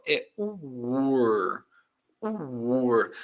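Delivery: "were" said slowly with a U-like W and a strong R; pronunciation demo. Shown as a demonstration. Teacher modelling a word for Brazilian learners. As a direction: stretch out "were" as woor, slowly. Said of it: "Olha a pronúncia".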